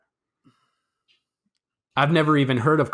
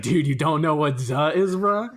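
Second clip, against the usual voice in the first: imitating manager; 2 semitones higher than usual. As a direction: echo the bro question with a rising tail. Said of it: "my manager walks in and he's like".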